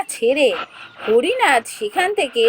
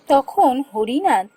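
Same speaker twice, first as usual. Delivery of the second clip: ordinary delivery, nothing marked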